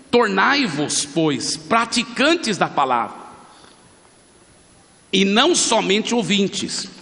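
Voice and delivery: slow, ringing Bible reading with long pauses; emphatic scripture reading. Real, not acted. Preacher reads James to the congregation, slowly proclaiming. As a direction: proclaim the verse phrase by phrase.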